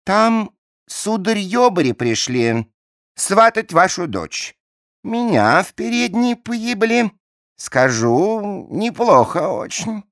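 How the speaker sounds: a hint of a bawdy maid; servant girl voice.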